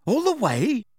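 high-pitched, incredulous questioning; astonished, pitch soaring at the end; thunderstruck. A narrator's reading. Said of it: "looking thunderstruck".

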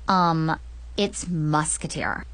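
put-on low, slow, hesitant boyish correction; awkward correction. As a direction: correct them gently after an awkward um. Boy corrects her mistake.

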